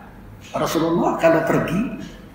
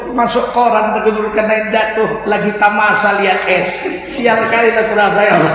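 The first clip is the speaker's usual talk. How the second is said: mock panicked prayer; 4 semitones higher, 4 dB louder than usual. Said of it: "Waktu itu saya nangis".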